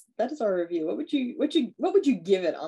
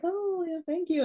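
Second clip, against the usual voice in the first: much higher, sugary fake thanks; mock sweet thanks; 12 semitones higher than usual. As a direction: play the naive girl, high and sweet. Mocking girl accepting condoms.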